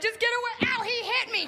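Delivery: high, shrill public breakup wail; high mock hysterics; hammed-up drama. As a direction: perform the victim for the street. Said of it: "I'd come outside and be like".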